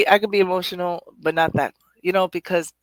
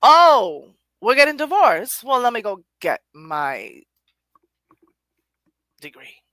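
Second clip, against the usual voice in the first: defiant retort; 4 semitones higher, 9 dB louder than usual. Pretend. Voicing her reaction.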